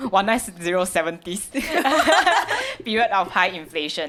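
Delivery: laughing through the words. Real, not acted. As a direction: giggle while correcting herself. Laughs at own slip.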